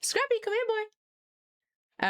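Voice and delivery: put-on high-pitched calling a puppy, come here boy; dog-calling voice. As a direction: call him in like the family dog. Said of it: "said your name like a dog".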